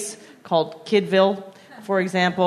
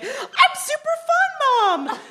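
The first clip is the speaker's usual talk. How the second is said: high, slow, exaggerated fun-mom voice; super fun mom; slower than usual. Mom mocking her own overeager fun voice, high and slow. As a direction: pitch it way up like a cartoon mom. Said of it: "I try to be like".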